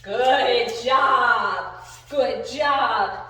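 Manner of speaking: loud, stretched-out praise; hearty praise. Real, not acted. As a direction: belt out each word to praise the dog. Praising dog.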